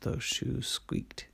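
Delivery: ordinary delivery, nothing marked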